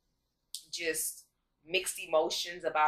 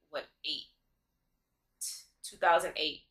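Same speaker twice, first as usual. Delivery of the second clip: ordinary talk, nothing marked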